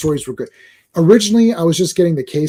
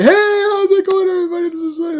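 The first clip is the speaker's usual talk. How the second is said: fake happy. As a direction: put on super happy cheer, hammed up. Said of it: "like, he's fake happy".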